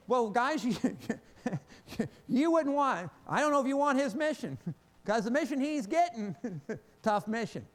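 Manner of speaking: loud and high, chuckling through it; laughing warning. Retold or imitated. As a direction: tease the guys with a laugh. Jesus warns the disciples, laughing.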